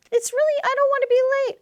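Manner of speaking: mock anxious child. Child worried about being late, anxious. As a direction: rush it out like a worried kid. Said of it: "child answering".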